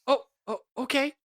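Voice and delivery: high, flustered little reply; flustered surprise. Acted. Tiefling called over with Biscuit, flustered high answer. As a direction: pipe up high, caught off guard.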